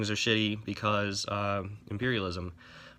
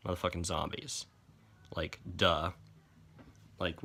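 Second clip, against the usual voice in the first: ordinary talk, nothing marked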